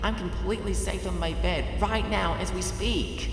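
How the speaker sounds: lightly voiced heroine, relieved; Twilight, relieved. A narrator's reading. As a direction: talk herself into feeling safe.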